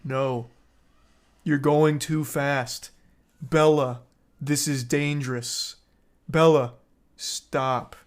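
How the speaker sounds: slow, spaced-out ghostly warning; Edward. Retold or imitated. Edward's Force Ghost warning Bella on the motorcycle, solemn and slow. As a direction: warn like an apparition, word by word with pauses. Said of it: "Force Ghost projections of Edward saying".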